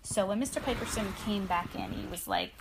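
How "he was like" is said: ordinary delivery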